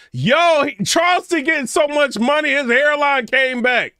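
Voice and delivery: loud, very high outburst; shouting in amazement, high-pitched throughout; astonished exclamation. Real, not acted. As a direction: yell it in comic disbelief. Exclaims at what he sees on screen.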